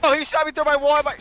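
surprised shout. Real, not acted. Shot through wall in game.

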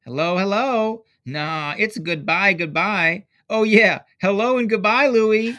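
high, loud taunting singsong; mocking kids. A narrator's reading. Dream kids mocking Louis, loud taunting. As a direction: jeer at him like mean kids.

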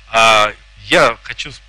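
normal talking voice

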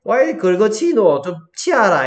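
loud, high Gyeongsang grandparent's scolding; Gyeongsang dialect. Acted. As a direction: swing it up like an old Gyeongsang speaker. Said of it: "경상도 사투리로". (경상도 할매처럼 크고 높은 사투리로)

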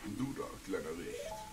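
low, quiet villain threat; menacing villain. Acted. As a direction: loom over the little guy. Voices Ganondorf text. (leiser, tiefer Bösewicht)

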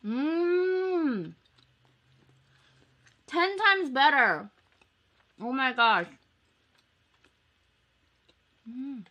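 chewing while talking; speaking between bites; mouth full. Real, not acted. Seen 0: Bites at -5 and -2; cheeks and lips still chewing while speaking.

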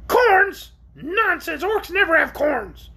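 shrill, high creature outburst; protesting. A narrator's reading. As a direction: scoff indignantly in the orc's piercing voice. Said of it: "protested the creature".

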